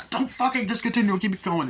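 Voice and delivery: high, indignant acted outburst; exasperated yelling. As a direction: play a frantic caller losing patience. Retold argument with car operator.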